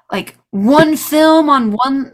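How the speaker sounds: ordinary delivery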